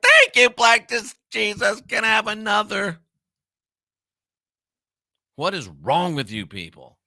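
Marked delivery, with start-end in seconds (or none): 0.0-3.0 high, put-on voice of an eager submissive; mock grateful sub. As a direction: beg for more in a mock-thankful voice. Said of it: "You're like".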